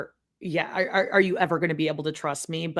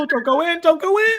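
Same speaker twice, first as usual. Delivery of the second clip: high, pleading mock warning; playful high-pitched plea to the screen; 12 semitones higher, 5 dB louder than usual. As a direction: call out don't go in in a squeaky panic.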